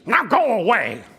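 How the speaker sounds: high, loud grouchy dismissal; Oscar voice. Acted. Oscar sending them away, loud grouch voice. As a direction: shoo them off. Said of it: "letting me do the voices".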